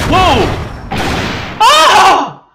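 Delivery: high, loud startled yelp; startled cry. Real, not acted. Player startled as the smoke gun fires, loud yelp. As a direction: jump at the bang.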